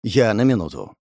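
plain reading voice

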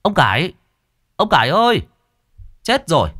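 loud, high calling out at the gate; urgent calling. A narrator's reading. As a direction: shout his name urgently from outside. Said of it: "Nghe giọng nói gấp gáp". (người báo tin gọi to gấp gáp)

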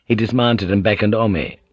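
plain reading voice

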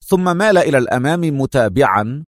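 ordinary delivery

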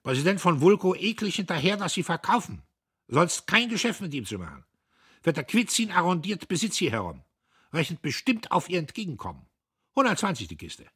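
high, loud, curt officer; loud high lieutenant voice; clipped officer voice. A narrator's reading. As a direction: bark it like a brash lieutenant. Lieutenant speaking.

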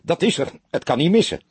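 high-pitched, emphatic confirmation; eager, certain exclamation; enthusiastic. A narrator's reading. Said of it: "riep hij enthousiast".

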